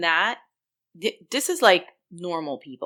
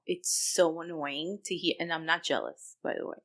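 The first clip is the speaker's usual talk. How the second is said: ordinary talk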